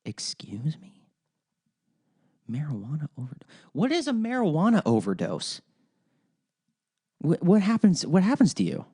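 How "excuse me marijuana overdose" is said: a stunned, airless excuse me; incredulous whisper. Real, not acted. Host reacting to a politician's claim, hushed disbelief. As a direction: breathe it out in disbelief, barely voiced. Incredulous at the drug policy quote.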